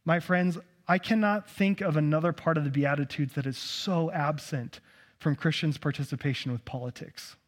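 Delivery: normal talking voice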